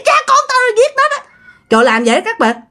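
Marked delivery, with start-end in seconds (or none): 0.1-1.3 high, fast gloating repeat; possessed man's voice. Retold or imitated. Ghost repeats the murder claim to the family, gloating. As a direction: taunt it fast and proud. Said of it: "giọng là giọng đàn ông ồn ồn ồn nha".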